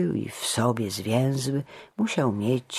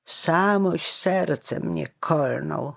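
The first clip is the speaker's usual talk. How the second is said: slow, raised lament of a wounded heart; complaining; 6 semitones higher, 3 dB louder, slower than usual. A narrator's reading. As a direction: bemoan it slowly, pitched up. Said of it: "skarżył się Jur".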